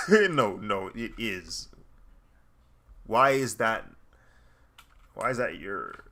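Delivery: ordinary talk, nothing marked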